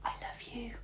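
soft loving whisper. A narrator's reading. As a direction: barely breathe it. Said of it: "she whispered to him".

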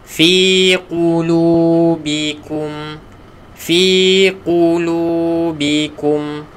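"Fi qulubikum" slow, measured chant; slow recitation. Shown as a demonstration. Teacher modelling the verse phrase, slow chant.

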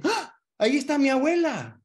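high, loud sudden delight at seeing grandma; excited recognition. Retold or imitated. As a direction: burst out as if spotting a loved one. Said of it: "Si dicen".

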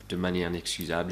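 ordinary talk, nothing marked

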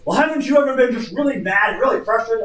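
high, loud, worked-up retort; retold outburst. Angry caller challenging the pastor on the phone, loud outburst. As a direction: raise the voice as the frustrated caller.

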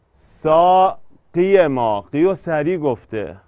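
سا قی ما chopped up for metre; slow scansion. Shown as a demonstration. Scanning verse syllable by syllable.

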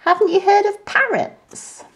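high, amused put-down; cheeky monkey teasing; scoffing monkey. A narrator's reading. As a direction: laugh off his fear. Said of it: "laughed and said".

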